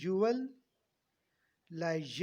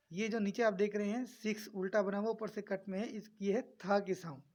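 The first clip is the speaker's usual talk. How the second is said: ordinary talk, nothing marked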